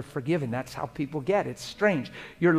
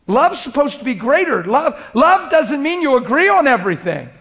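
rising, louder, higher preaching voice; 9 semitones higher, 7 dB louder than usual. Acted. Preacher urges couples, loud and high, to love. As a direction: preach it out to the congregation. Preacher builds on marriage point.

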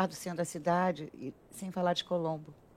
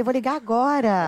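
excited; 8 semitones higher, 10 dB louder than usual. Retold or imitated. Retelling her eager reply.

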